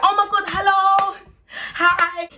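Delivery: high-pitched gushing hello; put-on cheery greeting. Singer hiding her upset on entering a room, put-on excitement. Acts putting on cheer despite depression.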